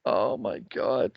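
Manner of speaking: low, slow, soft groan; exasperated oh my God. Real, not acted. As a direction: sink into exasperation.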